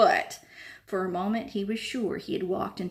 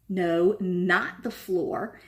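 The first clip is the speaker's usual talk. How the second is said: slow, stressing the floor; impatient correction; much slower than usual. A narrator's reading. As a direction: spell it out to him.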